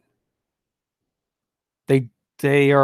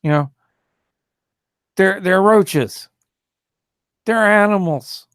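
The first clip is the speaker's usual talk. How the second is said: mock bigot; 5 semitones higher than usual.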